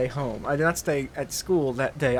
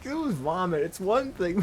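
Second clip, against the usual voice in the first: ordinary talk, nothing marked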